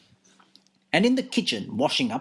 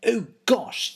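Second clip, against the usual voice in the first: high startled gasp of a cry; surprised cry; 8 semitones higher than usual. A narrator's reading. Dick seeing the lady shot again, high surprised cry. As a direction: Dick is taken aback. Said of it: "Dick cries".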